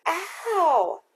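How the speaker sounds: piercing high ghostly shriek of pain; horrifying shriek. Acted. Dede voicing the ghost sister's pain, horrifying high shriek. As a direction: shriek so the listeners' skin crawls. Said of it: "Dede gave his version of the ghostly shriek".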